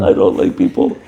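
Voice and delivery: low-pitched teasing imitation; mimicked catchphrase. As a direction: drop the voice to mimic a grumpy pal. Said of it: "He always says that".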